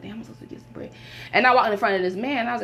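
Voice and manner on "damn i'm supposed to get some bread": muttering under her breath; a private whisper in the store; hushed self-talk; whispered to herself. Retold or imitated. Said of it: "I was like".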